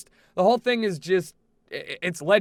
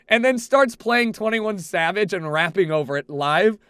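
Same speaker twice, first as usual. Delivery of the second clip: normal talking voice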